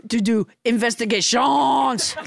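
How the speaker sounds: blustering mock-French proclamation; French Trump. French Trump threatens Biden and Pelosi, blustering French accent. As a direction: declaim it with absurd French vowels. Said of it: "your extremely realistic accent".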